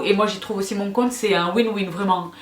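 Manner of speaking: ordinary talk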